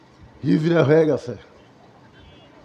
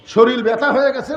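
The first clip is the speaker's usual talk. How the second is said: normal talking voice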